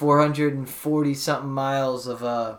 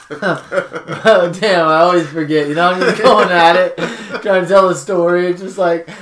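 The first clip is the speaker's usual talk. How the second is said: laughing; 3 semitones higher, 7 dB louder than usual. Real, not acted. Laughs at himself chewing ice.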